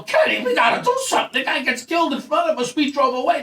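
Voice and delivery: high-pitched, agitated appeal; acted-out worked-up urging; urgent plea. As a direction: plead with your partner to act. Acting out urgent plea to partner.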